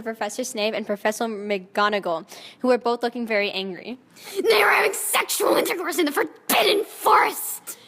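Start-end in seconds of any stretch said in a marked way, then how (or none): 4.3-7.8 furious yell. Acted. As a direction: denounce them in outrage. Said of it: "he yelled in a furious voice".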